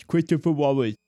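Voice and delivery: "Christopher Wallace" with a mocking lisp, the r's gone and slurred; mocking a speech impediment. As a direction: slur "Christopher Wallace" like a teasing impression. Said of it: "or if I would talk like Chris Wallace".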